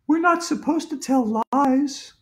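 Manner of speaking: high schoolgirl voice, slightly slow; preachy girl. A narrator's reading. Andrea lectures the principal about lies, high and prim. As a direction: lecture him in a high girl's pitch.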